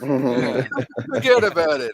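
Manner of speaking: lightly mocked Bronx accent; imitation Bronx catchphrase. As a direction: toss it off like a Bronx wise guy. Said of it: "you come from the Bronx sometimes".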